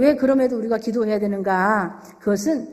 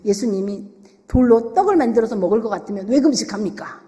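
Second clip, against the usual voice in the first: ordinary talk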